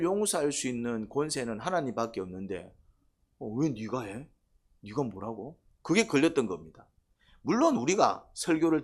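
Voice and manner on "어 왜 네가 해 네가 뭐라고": sneering mutter. Retold or imitated. 바리새인의 속말 흉내.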